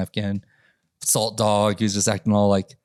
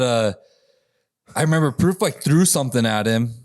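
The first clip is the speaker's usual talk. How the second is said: normal talking voice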